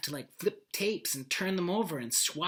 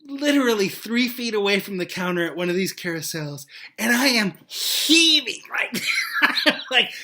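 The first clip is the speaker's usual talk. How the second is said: worked-up retelling; 6 semitones higher, 9 dB louder than usual. Retold or imitated. Reliving the sobbing moment.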